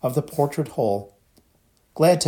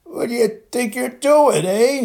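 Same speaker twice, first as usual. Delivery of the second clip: a giant's sudden furious roar, much higher and louder; 13 semitones higher, 6 dB louder than usual. A narrator's reading. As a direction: bellow it so they jump. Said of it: "he roared".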